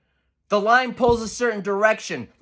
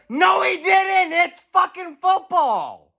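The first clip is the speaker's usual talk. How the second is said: angry shout; 8 semitones higher than usual. Real, not acted. Angry rebuttal.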